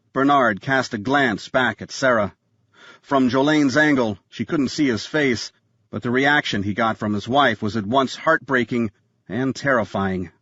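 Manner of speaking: ordinary delivery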